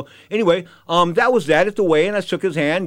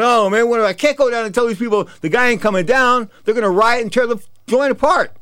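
high, louder panicked rush; 7 semitones higher, 4 dB louder than usual. Retold or imitated. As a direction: panic about the crowd rioting. Panicked promoter fears a riot.

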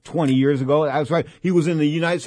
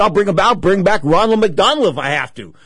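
louder, higher, eager retold offer; eager promise; 5 semitones higher, 8 dB louder than usual. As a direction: play up how keen he was to help.

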